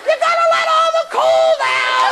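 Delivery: high, drawn-out mock screech; hammed-up scolding from another room; screaming mother. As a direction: shriek it like a nagging mom. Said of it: "mother screams".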